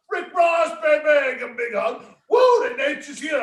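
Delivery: high, loud party shout. Retold or imitated. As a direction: holler it like a hyped wrestler greeting a friend.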